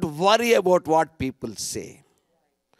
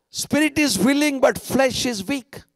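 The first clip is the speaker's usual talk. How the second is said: usual speaking voice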